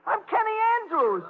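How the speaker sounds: high-pitched frightened plea; desperate protest. Acted. Man at gunpoint telling gangster his name, desperate high protest. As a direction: insist on who you are.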